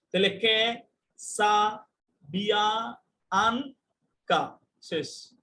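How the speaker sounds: ক্যাসাবিয়াঙ্কা broken as কে, সা, বিয়া, আন, কা with a pause after each; syllable by syllable. Shown as a demonstration. Teacher showing students how to split ক্যাসাবিয়াঙ্কা into syllables. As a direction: split কে সা বিয়া আন কা into separate beats. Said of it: "শব্দ ভেঙে ভেঙে যে উচ্চারণ করা যায়".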